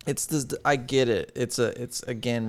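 ordinary delivery

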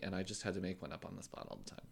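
normal talking voice

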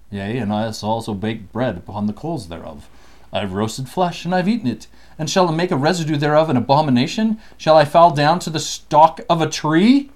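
deep, booming scripture voice; solemn scripture reading. Acted. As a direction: read it like a prophet's proclamation. Reads scripture passage aloud.

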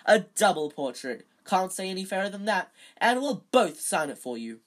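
loud, hearty offer; beaming. A narrator's reading. Teacher offering Colin a double portrait, beaming. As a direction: sell it with showy cheer. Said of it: "beaming at Colin".

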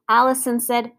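ordinary delivery

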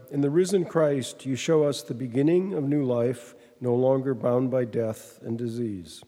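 normal talking voice

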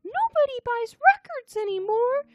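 squeaky high Muppet voice; helium-high puppet voice, loud. Acted. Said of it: "Your Professor K voice is like ... huffing helium".

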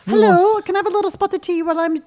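high, put-on posh British voice; posh English accent. Shopper asking for tea in Doc Martens, posh English accent. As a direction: play a very proper English shopper. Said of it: "a really posh accent".

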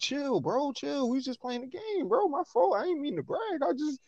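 high, nervous frat bro backing off. Retold or imitated. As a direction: squeak out a scared apology, bro. Dude backing down from dad.

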